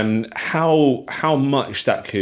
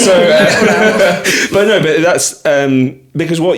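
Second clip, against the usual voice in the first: laughing; 7 semitones higher, 11 dB louder, much slower than usual. Real, not acted. Host recovering from her own laughter. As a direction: still laughing, louder. Still laughing after outburst.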